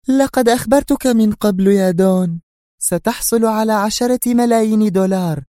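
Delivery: high, loud woman's voice, astonished; exclaiming Sonia. A narrator's reading. Sonia reminds the Don of the ten million, astonished. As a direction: blurt it out, surprised he asks. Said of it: "وهي تهتف".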